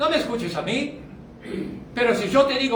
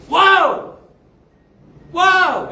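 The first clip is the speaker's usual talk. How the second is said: loud, high exclamation of delight; thrilled shout of wow; excited wow; 9 semitones higher, 8 dB louder than usual. Real, not acted. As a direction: burst out in amazement. Exclaiming at good theology.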